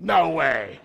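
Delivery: retold burst of disbelief; disbelieving. Dad retelling his reply to his son, disbelieving. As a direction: blurt it out in mock shock. Acted disbelief at son's news.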